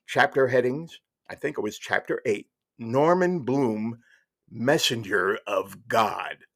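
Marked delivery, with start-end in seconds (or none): 2.8-6.4 slow, weighty reading of a title; grand announcement. Retold or imitated. Reading a shocking chapter title aloud, slow and portentous. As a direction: draw out each word for the reveal.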